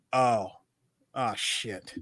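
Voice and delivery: low, quiet sinking realization; mock letdown. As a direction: drop low and soft, imitating a crushed reaction. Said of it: "He just went".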